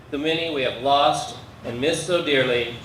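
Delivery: ordinary talk, nothing marked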